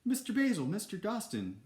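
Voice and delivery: put-on high-pitched little-girl cry of relief; acted, high excited calling. A narrator's reading. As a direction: call out high and eagerly to your rescuers. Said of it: "cried Olivia".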